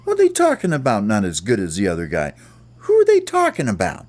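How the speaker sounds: high, quick little bird voice; Smalls' squeaky voice. Acted. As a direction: rattle it off like a puzzled little bird. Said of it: "And Smalls was like".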